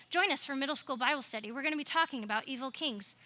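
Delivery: fast, tossed-off announcement; quick aside plug. Real, not acted. Youth pastor plugging middle school Bible study to kids, rushed aside. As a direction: rattle off the ad before getting back to the story.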